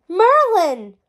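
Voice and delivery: high, loud joyful call. A narrator's reading. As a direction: shout the name as the door flies open. Bursting into the room.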